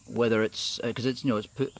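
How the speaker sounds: usual speaking voice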